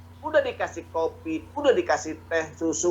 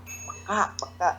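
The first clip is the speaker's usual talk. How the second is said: breathy whisper of the word; soft whispered emphasis; 6 dB quieter than usual. Real, not acted. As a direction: hush it like a secret. Stressing sensitivity.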